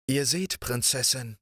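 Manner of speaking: a pleased, smiling greeting; smiling prince. A narrator's reading. Riquet greets the princess, lightly voiced and smiling. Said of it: "sagte er lächelnd".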